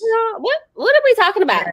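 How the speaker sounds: loud, high-pitched mock exasperation; exasperated bafflement. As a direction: act out being totally baffled, voice shooting up. Retold baffled reply to daughter.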